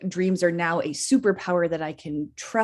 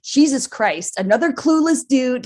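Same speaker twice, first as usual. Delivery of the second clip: annoyed exasperation; 5 semitones higher, 5 dB louder than usual. Retold or imitated. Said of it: "so annoyed in the dream".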